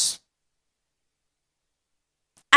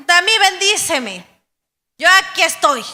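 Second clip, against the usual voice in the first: ordinary delivery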